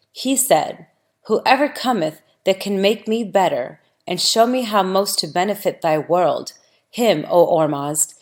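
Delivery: ordinary delivery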